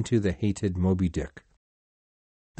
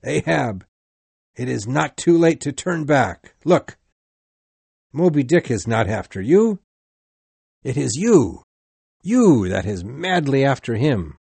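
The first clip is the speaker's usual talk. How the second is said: urgent, raised plea, a little higher; moderately raised call, pressing each phrase; 4 semitones higher, 4 dB louder than usual. A narrator's reading. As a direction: call down in earnest appeal. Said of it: "Starbuck called down to him from the deck".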